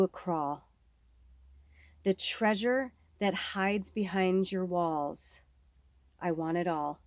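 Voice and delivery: slow, soft reading of a card message; slow soft oracle reading. Acted. Reader voicing the card to viewers, slow and soft. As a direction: read it like a gentle oracle speaking.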